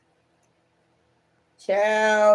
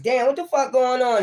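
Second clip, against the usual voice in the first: higher, imitating someone baffled; mock bewildered quote; 6 semitones higher than usual. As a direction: play the baffled seeker, pitched up. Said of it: "you was like".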